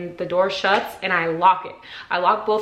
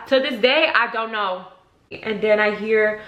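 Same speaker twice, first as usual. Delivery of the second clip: ordinary delivery, nothing marked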